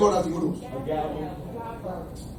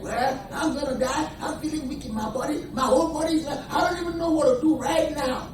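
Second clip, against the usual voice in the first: mock feeble complainer; 6 semitones higher, 4 dB quieter than usual. Mimics a weak complaining believer.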